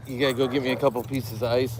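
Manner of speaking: ordinary talk, nothing marked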